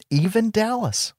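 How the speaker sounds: normal talking voice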